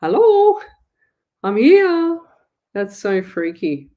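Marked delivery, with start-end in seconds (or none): 0.0-2.2 much higher and louder ghostly call; a ghost announcing itself, hammed up; high, loud mock-eerie hello; spooky ghost voice. Voicing the ghost in photo.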